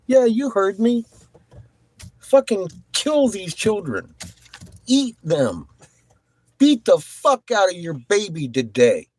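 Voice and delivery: high, forceful over-the-top command; worked-up rant. Real, not acted. Streamer egging on moderators, worked-up rant. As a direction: double down, punching every order. Doubles down on the violent order.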